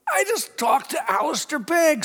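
very high, squealing excitement; giddy disbelief. Retold or imitated. Pastor marvelling after his hero's call, giddy and high-pitched. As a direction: gush it like a starstruck fan.

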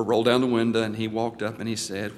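ordinary talk, nothing marked

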